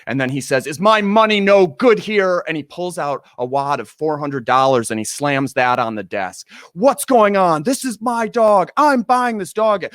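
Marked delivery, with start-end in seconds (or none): 0.7-2.5 higher, louder outraged demand; mock furious yell at the clerks; indignant shout. Rude stranger yells at shelter staff over his money, higher and louder. Angry man slams cash on desk. 6.7-9.8 higher, louder outraged demand; mock furious yell at the clerks; indignant shout. Rude stranger yells at shelter staff over his money, higher and louder. Man fights for the dog.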